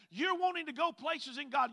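ordinary delivery

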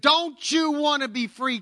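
put-on high-pitched Southern-accented call. Southern soldier to captive Captain Bank, Southern accent. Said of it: "Captain Bank recognized a Southern accent".

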